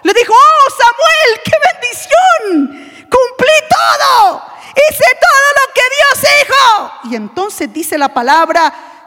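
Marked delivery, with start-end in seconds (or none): 0.3-6.9 high, slow, shouted self-praise; shouting out triumphant, self-satisfied obedience; proud boast. Acted. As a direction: crow about having done everything. Saul boasting to Samuel.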